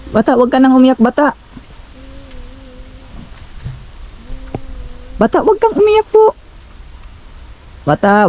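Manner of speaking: insistent questioning call. Real, not acted. Calls out to crying child.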